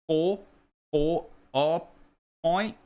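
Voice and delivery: put-on tentative British accent practice; halting mock British attempt. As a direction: test out British vowels, unsure. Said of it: "go for a pint in British".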